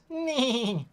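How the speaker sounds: high braying laugh like a donkey; whinny the laugh out high; a put-on braying giggle; neighing donkey laugh. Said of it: "I sound like a neighing donkey".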